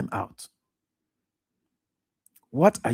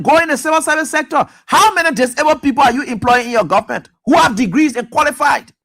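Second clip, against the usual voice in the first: normal talking voice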